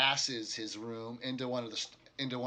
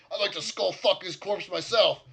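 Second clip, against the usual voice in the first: louder, higher seething threat; angry orc; 8 semitones higher, 10 dB louder than usual. Acted. Orc innkeeper repeating his vow of revenge, loud and higher. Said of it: "and so".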